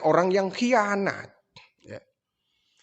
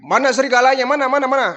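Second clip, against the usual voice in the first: high, louder demand; urgent demand; 7 semitones higher, 3 dB louder, much faster than usual. Retold or imitated. Villager rushing in to find the wolf, urgent. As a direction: press them, where is it.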